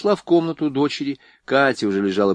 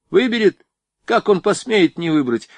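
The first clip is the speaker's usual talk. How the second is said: "Выберет?" thrown back high and loud, indignant; stubborn; 9 semitones higher, 4 dB louder than usual. A narrator's reading. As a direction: scoff at the very idea, defiant. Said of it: "упрямо проговорила Варя".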